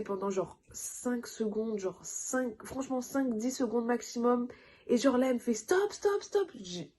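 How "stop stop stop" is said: high quick stop stop; urgent stop. Retold or imitated. Midwife telling the mother to stop, high and urgent. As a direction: halt her urgently.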